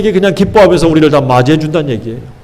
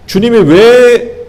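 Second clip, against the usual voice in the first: slow emphatic question; 6 semitones higher, much slower than usual. Real, not acted. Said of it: "preacher slows for emphasis".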